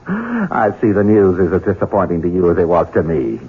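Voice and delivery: normal talking voice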